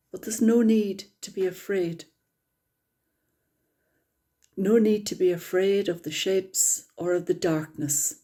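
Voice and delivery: calm, unhurried meditation guide; guided meditation. Acted. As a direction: ease the group gently into stillness.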